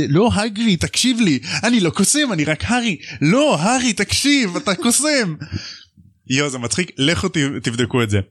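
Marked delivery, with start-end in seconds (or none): 0.1-3.0 pitched up, half-mock plea; high pleading Harry. Harry to Hagrid, insisting he's no wizard, high mock whine. 3.2-5.3 pitched up hammed-up insisting; high mock insistence. Hagrid to Harry, insisting he's a wizard, high hammed-up.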